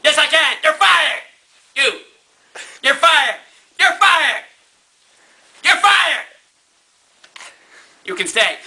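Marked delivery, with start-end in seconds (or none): 0.0-6.3 angry boss. Acted. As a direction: yell the firing at him. Nixon keeps firing.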